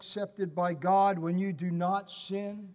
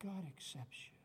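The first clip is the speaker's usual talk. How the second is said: soft echo; 14 dB quieter than usual. Acted. Repeated reassurance, softer echo.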